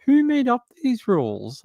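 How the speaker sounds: high-pitched mock exasperation; baffled complaint. Acts out baffled complaint at spelling rules.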